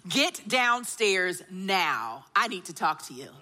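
low, slow, stern mommy voice. Retold or imitated. Mother ordering her son downstairs, stern slow low voice. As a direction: lay down the law like a mom. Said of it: "I put my mommy voice on".

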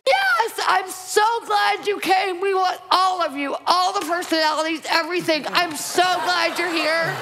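high, excited shout of welcome. Retold or imitated. To the woman in the wheelchair, an overjoyed high shout. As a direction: throw the arms wide and cheer them in. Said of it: "And I'm like".

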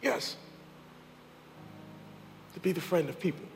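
soft, low, intimate appeal; quiet sermon close. Acted. Preacher speaking softly to listeners at the close. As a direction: draw them in gently.

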